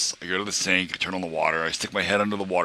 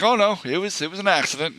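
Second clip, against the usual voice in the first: flustered denial; 11 semitones higher than usual. Retold or imitated. Defending himself to ER staff.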